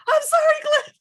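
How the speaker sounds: high, loud, squeaky sorry; mock apology. Imitating her apologizing to Cliff, high and loud. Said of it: "And she's like".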